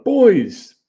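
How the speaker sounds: loud, high greeting shout; yelling a hello to the DJs; retold yell. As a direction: boom it out across the empty hall. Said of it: "I hear someone yell out".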